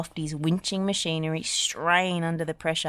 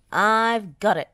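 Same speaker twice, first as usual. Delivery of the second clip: roaring; 4 semitones higher, 8 dB louder than usual. Acted. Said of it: "roared Muck".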